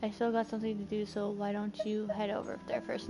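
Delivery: offhand read-out. Real, not acted. Player reading out a reply option, quick and quiet. Reads dialogue option before rejecting it.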